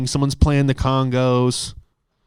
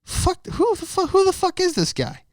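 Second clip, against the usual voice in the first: indignant inner rant. Retold or imitated. Comic fuming about the judge, high indignant whine. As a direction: rise into a disbelieving outraged whine. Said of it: "I'm like".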